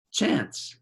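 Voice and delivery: ordinary delivery, nothing marked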